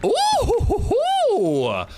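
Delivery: very high "ooh-hoo-hoo"; high celebratory hooting; excited whoop. Real, not acted. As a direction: falsetto whooping hoot. Reacting mid-story with a whoop.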